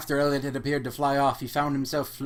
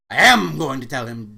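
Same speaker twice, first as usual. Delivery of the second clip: firmly, much louder; firm insistence; 11 dB louder than usual. A narrator's reading. Sister insisting to brother she will tell, firm and loud. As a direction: insist on telling him outright.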